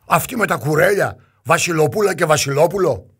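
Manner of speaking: loud, high, indignant outburst; scornful disbelief. A narrator's reading. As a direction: scoff loudly at the ragged visitors. Rich brother scorns ragged newcomers.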